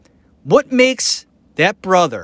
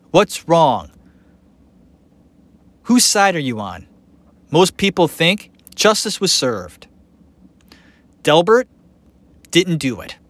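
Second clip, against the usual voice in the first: ordinary talk, nothing marked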